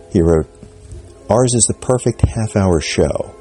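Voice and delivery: ordinary talk, nothing marked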